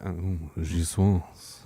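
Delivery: very slow, drawn-out soul-singer croon. Pretend. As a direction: stretch it out like a smooth seducer.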